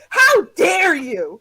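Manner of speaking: slow indignant mock gasp of offence; hammed-up offended man; mock outrage. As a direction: act out his outrage, drawn out. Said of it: "and then goes".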